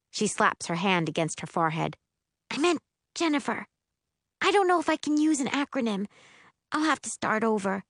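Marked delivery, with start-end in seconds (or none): 2.5-7.8 put-on high-pitched girl correcting herself; earnest, slightly flustered sister; flustered young spellcaster. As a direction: fuss over the mistake in a high voice.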